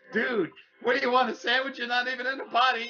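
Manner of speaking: high, laughing retort to an unseen visitor; laughing at him. Retold or imitated. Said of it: "And I was laughing".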